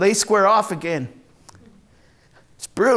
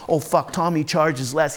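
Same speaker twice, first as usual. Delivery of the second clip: usual speaking voice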